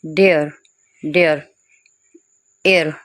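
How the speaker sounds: डियर as a stretched "de-ar"; isolated एयर with a gliding vowel; drawn-out sound. Shown as a demonstration. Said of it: "इसको थोड़ा खींच कर बोलना है".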